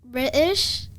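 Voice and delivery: a one-word try at a British accent. Shown as a demonstration. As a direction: say it with an attempted British accent, tentatively rising. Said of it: "can you do one".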